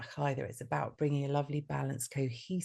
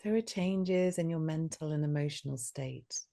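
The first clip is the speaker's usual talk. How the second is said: guided meditation. Acted. Leads heart meditation.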